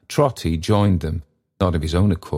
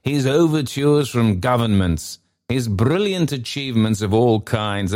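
higher-pitched, gushing delivery; effusive story character; 6 semitones higher than usual. Acted. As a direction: swoop up high through the phrases.

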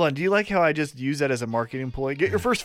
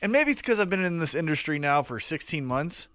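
usual speaking voice

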